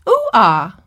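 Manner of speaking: "Ooh-ah" as two bare vowels, no d or g; toddler's incorrect vowels. Retold or imitated. Teacher repeats the toddler's attempt, "Ooh-ah" in wrong vowels. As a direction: mispronounce doggy as "Ooh-ah". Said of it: "the child points to the animal and says".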